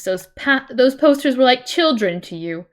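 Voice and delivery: normal talking voice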